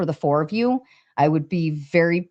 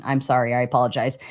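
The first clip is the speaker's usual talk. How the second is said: ordinary delivery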